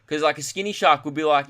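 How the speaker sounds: ordinary talk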